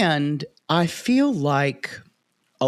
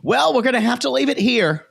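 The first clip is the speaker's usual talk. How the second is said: high-pitched mock host sign-off; Sean impression; 9 semitones higher than usual. Podcaster mocking the tell-all host cutting off, high imitation. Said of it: "when she goes like".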